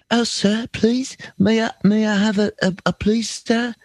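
very high, pleading British waif. Shown as a demonstration. Showing one of three British voices, high pleading British. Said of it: "I've got three British voices".

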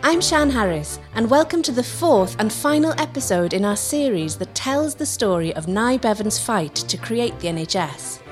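ordinary delivery, nothing marked

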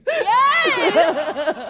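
high, loud whoop of yes; delighted cheer. Real, not acted. Co-host cheering on the impression, loud delighted yell. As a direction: cheer it out at full voice.

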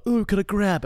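eager kiss-seeker. Acted. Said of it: "looking around like".

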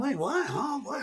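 high, baffled double take; mock confusion. Acting out watching the film, high and baffled.

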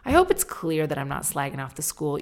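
ordinary talk

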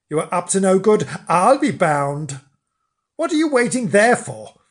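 Mister Flange getting cross. Acted. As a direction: scold the figure in the dark. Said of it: "Mister Flange grew cross".

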